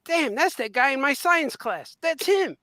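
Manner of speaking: realising the actor was his classmate, mock amazed; excited recognition. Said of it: "and I'm saying".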